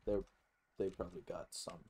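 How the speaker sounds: normal talking voice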